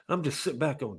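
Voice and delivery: usual speaking voice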